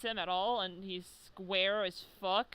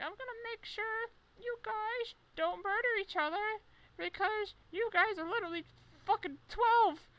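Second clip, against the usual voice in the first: put-on girly falsetto Chizuru; 14 semitones higher than usual. Chizuru voiced scolding the fighters.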